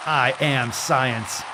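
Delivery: slow, grand "I am science"; slow mock decree; pompous authority impression. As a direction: pronounce it like a self-appointed oracle. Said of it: "Was that a good impression?".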